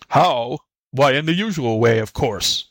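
quick, impatient retort; mildly irritated tiger voice; exasperated tiger. Acted.

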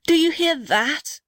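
high, drawn-out alarmed question; acted high exclamation; urgent cry. A narrator's reading. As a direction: ask it in storybook alarm, pitch swooping. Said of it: "cried the big blue gum".

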